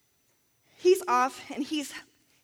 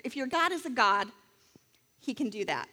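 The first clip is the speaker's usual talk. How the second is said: ordinary delivery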